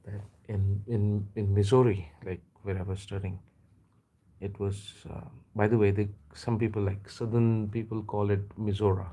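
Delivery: ordinary talk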